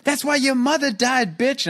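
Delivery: high-pitched retold taunt; high mock jeer; mocking imitation. Imitates the woman's parting taunt.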